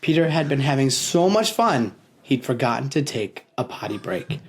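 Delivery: plain reading voice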